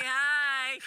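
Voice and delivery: high, loud, limp little "hi"; mock wimpy greeting. Comedian meeting a rough-gripped driver, mock wimpy. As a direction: send yourself up with a high-pitched wimpy greeting. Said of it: "I immediately feel like such a pussy".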